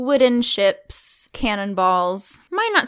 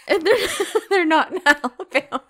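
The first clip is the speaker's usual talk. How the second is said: higher, quick words broken by laughing; 7 semitones higher than usual. Real, not acted. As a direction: talk through a burst of laughter. Laughing at co-host's mix-up.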